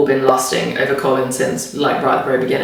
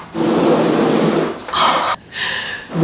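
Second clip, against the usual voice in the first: long delighted gasp; surprised gasp. Real, not acted. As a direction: gasp in awe. Finding the fan.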